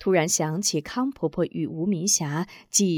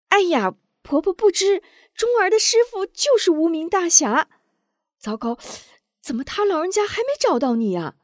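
much higher, loud and hurried; anxious; 11 semitones higher, 5 dB louder than usual. A narrator's reading. Madam Wu hurriedly explains to Granny, anxious and high. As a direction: blurt it out in a panic. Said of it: "吴夫人着急道".

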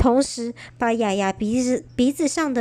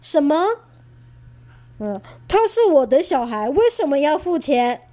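indignant cry; 4 semitones higher, 3 dB louder than usual. Acted. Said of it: "爸爸大叫".

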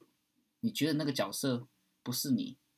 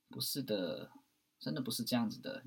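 ordinary talk

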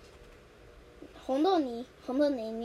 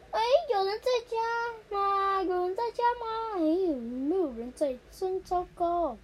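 higher, loud, slow call; calling at the door; 7 semitones higher, 4 dB louder than usual. Acted. Student calling at the front door, high and loud. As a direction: call out to a house nobody answers. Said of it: "在门口喊".

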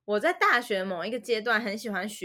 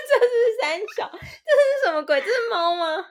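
high, loud laughing what-is-that; laughing disbelief; 11 semitones higher, 5 dB louder than usual. Real, not acted. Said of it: "reacting to cat noise".

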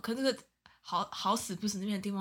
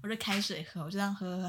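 ordinary delivery

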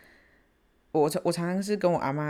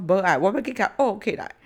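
louder, defiant outburst; angry refusal; 4 semitones higher, 6 dB louder than usual. Retold or imitated. Daughter refusing mom's plea, loud angry retort. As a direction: snap back, refusing to calm down.

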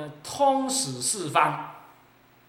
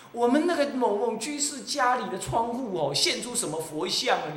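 bragging devotee, pitched higher; boastful devotee; 7 semitones higher than usual. Acted. Monk mimics a devotee bragging about a miracle, boastful. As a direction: show off a miracle at home.

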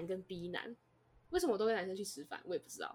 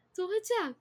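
stunned disbelief; 10 semitones higher, 5 dB louder, faster than usual. Retold or imitated. Stunned by B's betrayal.

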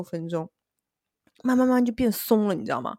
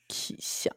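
angry laugh; 9 dB quieter than usual. Real, not acted.

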